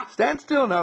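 high, loud cheerful order; chirpy stand-still call; jolly command. Retold or imitated. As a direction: playfully tell him to hold still. Voiced helper in story.

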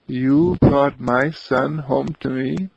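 disbelieving joy; mother amazed the boy brought her son home. Acted. Mother realises her son is back.

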